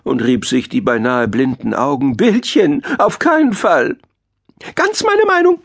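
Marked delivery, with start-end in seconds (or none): 2.2-4.0 high thin protesting mole; thin-voiced mole. A narrator's reading. Nearly blind mole rejects the pictures, thin high protest. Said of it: "mit seiner dünnen Stimme". 4.8-5.6 extremely high, tiny bat squeak. A narrator's reading. Bat chimes in agreeing with the mole, very high squeak. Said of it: "piepste die Fledermaus".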